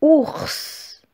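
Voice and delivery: syllabe détachée, lente et nette, plus aiguë; dictée articulée. Shown as a demonstration. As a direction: dicter comme une maîtresse à l'élève. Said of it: "Alors c'est parti". (voix de dictée bien articulée)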